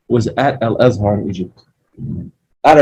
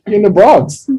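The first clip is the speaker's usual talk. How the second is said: mock confident answer; 10 semitones higher, 5 dB louder than usual. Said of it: "I'm like".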